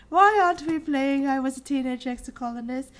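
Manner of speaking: high, loud whining question; whiny quote. Retold or imitated. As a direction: whine it like a pestering kid. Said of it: "Debs G is like".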